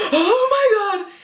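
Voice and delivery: overjoyed shriek at the trick; excited squeal. Retold or imitated. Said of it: "he's like".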